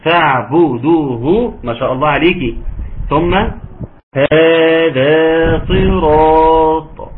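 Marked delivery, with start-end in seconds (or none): 0.0-1.5 فاعبدوه slow, rounding bu and du fully; full damma. Shown as a demonstration. Teacher stressing the damma, slow and clear. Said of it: "تحقيق الضم". 4.2-7.1 long drawn tajweed chant; Quran recitation. Shown as a demonstration. Teacher reciting to show the natural madd, slow chant.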